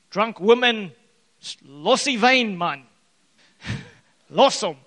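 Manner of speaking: harsh pastor. Acted. Pastor rips into praying woman.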